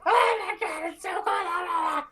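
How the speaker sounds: very high squealing kobold wail; hammed-up falsetto scream from the cold; freezing screaming. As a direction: shriek like a freezing little creature, high-pitched. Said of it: "this screaming cavalcade".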